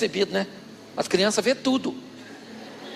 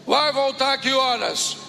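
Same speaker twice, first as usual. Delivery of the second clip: gruff uncle voice; 5 semitones higher, slower than usual. Retold or imitated.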